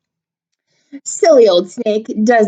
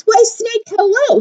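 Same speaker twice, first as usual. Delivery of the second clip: mouse voice; 13 semitones higher than usual. Acted. Mouse greets snake.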